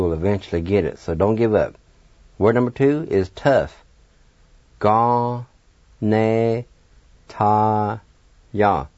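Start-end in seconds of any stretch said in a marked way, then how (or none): 4.8-8.9 "Ga, Ne, ta, ya" with a pause after each syllable; four held syllables, "Ga... Ne... ta... ya". Shown as a demonstration. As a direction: sound out Ga, Ne, ta, ya separately. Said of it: "now I'll pronounce these words".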